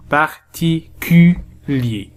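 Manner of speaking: "Particulier" very slowly as par, ti, cu, lier; syllable by syllable. Shown as a demonstration. Teacher rebuilding the word from syllables, "Particulier" slowly split. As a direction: separate each syllable of "Particulier". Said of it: "Troisième syllabe".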